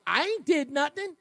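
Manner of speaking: high, put-on whiny voice of a guy playing innocent; mock innocent denial. Host mocking the coward's denial, whiny put-on voice. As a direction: whine it like a kid caught out. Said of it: "acts like".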